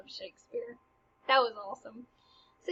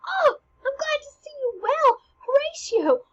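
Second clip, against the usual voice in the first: high, bright-voiced happy greeting to his best friend; excited greeting; 6 dB louder than usual. Hamlet greeting his best friend Horatio, much higher and thrilled. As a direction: squeal it like seeing your best friend. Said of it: "he's like".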